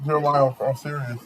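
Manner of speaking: usual speaking voice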